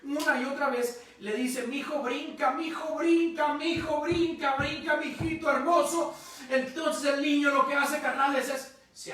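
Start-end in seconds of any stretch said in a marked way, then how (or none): 1.7-6.1 higher, drawn-out urging, over and over; insistent father. Retold or imitated. Father urging his son to jump into his arms, insistent. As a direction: coax with rising insistence as an acted papá. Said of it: "el papá le seguía insistiendo".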